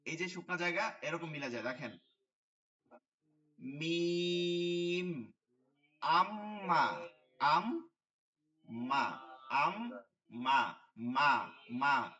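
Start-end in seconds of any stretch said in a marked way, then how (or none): 3.6-5.3 very slow, humming মিম; মিম held long through closed lips; drawn-out mim. Shown as a demonstration. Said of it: "মিম হয় হচ্ছে এইখান থেকে". 6.0-12.1 slow আম্মা split into আম and মা; syllable drill. Shown as a demonstration. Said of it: "ঠিক আছে?".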